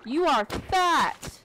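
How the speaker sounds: high gasp then slow, loud mock accusation. Gamer teasing a fat mob, mock shocked. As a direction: gasp in fake shock and drag it out.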